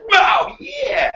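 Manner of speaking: slow, leering catcall rising to a high whoop; mock leering admirer. Teacher playing a suitor eyeing the colonies' raw materials, leering. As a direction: ogle like a sleazy admirer. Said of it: "I'll kinda go".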